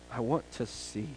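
slow, low and soft; hushed answer. Acted. Blind man telling Jesus his greatest need, slow and hushed. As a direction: say the wish slowly and quietly. Repeating Bartimaeus' answer slowly.

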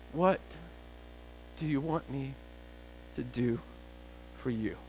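very soft, spaced-out words; gentle question. Acted. Jesus asking the blind beggar what he wants, soft and halting. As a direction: ask it softly, pausing between phrases.

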